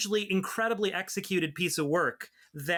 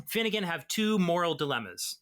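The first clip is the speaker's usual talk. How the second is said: ordinary talk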